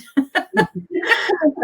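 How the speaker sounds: high burst of laughter; delighted laugh. Real, not acted. Host laughs at comment.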